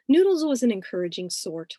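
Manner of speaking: usual speaking voice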